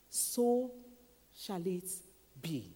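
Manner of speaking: usual speaking voice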